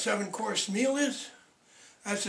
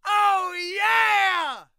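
big high whoop, much louder; whooping cheer; 11 semitones higher, 9 dB louder than usual. Real, not acted. Comedian celebrating his own punchline with a loud whoop. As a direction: cheer it out loud like a crowd-pleaser.

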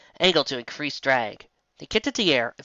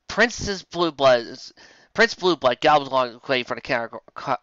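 plain reading voice

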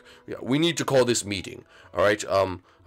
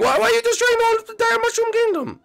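very high cartoon scolding; indignant outrage; 5 dB louder than usual. Hero confronts the villain over the intercom, indignant. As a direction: demand answers in a strained falsetto.

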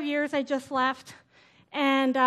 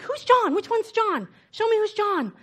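higher, puzzled questions; a friend asking who John is; confused friend; 5 semitones higher than usual. Retold or imitated. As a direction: act baffled and searching. Friend's confused retort retold.

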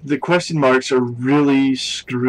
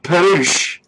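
"Padouche!" called out a bit louder, falling; mock exclaim; 5 semitones higher, 7 dB louder than usual. Echoing friend's cry with a mock proclamation. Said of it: "I like that".

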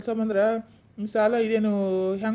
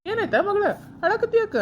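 high, worried parent's questions; alarmed concern; 10 semitones higher than usual. Acted. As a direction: ask urgently what is wrong. Parent asks weeping daughter what happened.

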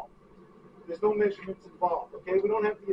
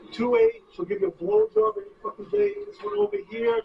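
loud, high-pitched hard sell; worked-up, pushy persuading; heated persuasion; 13 semitones higher, 5 dB louder than usual. Acted.

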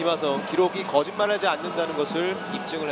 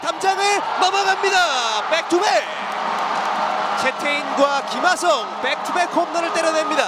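shouted excited play-by-play, higher; home run call; 8 semitones higher, 3 dB louder than usual. Acted. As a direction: build to a shout as it clears the fence. Live back-to-back home run call.

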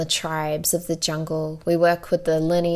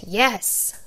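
bold proclamation; 5 semitones higher than usual. Retold or imitated. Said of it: "boldly proclaimed".

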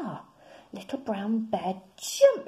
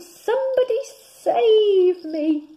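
much higher, louder cry for help; frightened shout; 13 semitones higher, 12 dB louder, slower than usual. Acted. Rabbit cries for help as his ears twirl. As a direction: yelp in panic. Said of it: "he shouted".

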